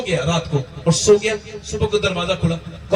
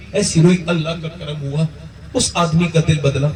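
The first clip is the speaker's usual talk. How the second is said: normal talking voice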